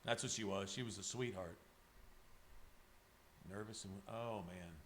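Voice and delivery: barely holding it together, voice sinking; choked up. Real, not acted. Artist tells viewer about her late dog, softly choked up. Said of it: "Now you got me tearing up".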